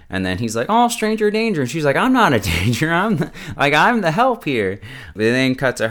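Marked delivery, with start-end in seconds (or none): 0.7-1.6 very high cry of warning; hammed-up kid sounding the alarm; mock alarm. Kid caught stealing a candy bar, mock alarm. 2.0-4.8 high, louder insistence with a laugh in it; mock indignant protest. Kimmy answering the kid she stopped, mock indignant.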